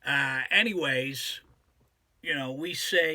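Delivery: ordinary talk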